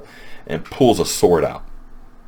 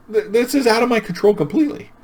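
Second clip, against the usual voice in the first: much higher, louder squeaky panic; panicky protest; 5 dB louder than usual. Retold or imitated. Boyfriend squeaks his protest to Gran, high and panicked. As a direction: squeal it like he's lost all control. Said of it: "He's like".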